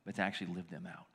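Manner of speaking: low, hushed prayer voice; quiet prayer. Acted. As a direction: pray softly and reverently. Closing prayer.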